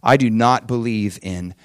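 ordinary talk, nothing marked

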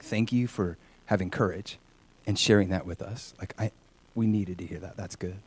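low, hushed, sincere thanks; quiet gratitude. Retold or imitated. Student thanks classmate for having courage, quietly grateful. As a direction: confide it softly, fading almost away.

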